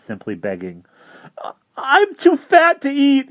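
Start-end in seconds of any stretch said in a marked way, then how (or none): 1.8-3.3 high, loud, slow panicked whine; frantic pleading. Acted. Boy pleads with vampire, high frantic whine. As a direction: bargain desperately for your life. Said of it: "a new round of supplications".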